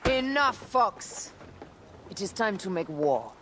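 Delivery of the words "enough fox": slow firm cutting rebuke; stern rebuke. Acted. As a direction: shut down the teasing. Stern rebuke to teasing captain.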